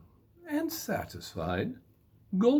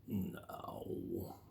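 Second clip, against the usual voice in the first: whispered aside; 3 semitones lower, 13 dB quieter than usual. A narrator's reading. Narrator aside to listener.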